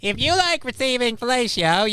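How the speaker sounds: very high-pitched cartoon voice; SpongeBob. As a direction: play it as a squeaky cartoon character. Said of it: "I'm slipping into a SpongeBob voice".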